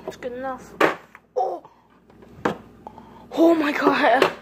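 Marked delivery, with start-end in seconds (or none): 1.4-4.3 startled exclamation; surprised. Real, not acted. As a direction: gasp it out in shock. Nearly cracked an egg.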